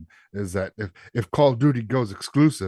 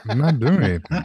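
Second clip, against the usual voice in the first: sultry voice; 3 semitones higher, 6 dB louder, faster than usual. Acted. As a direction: play it seductive and innocent. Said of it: "He turned on the sultry voice".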